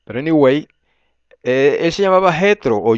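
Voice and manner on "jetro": Jetro with a Spanish J, stressed on JE; Spanish form. Shown as a demonstration. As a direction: say Jetro with an h-like first sound. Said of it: "Biblias españolas dice Jetro".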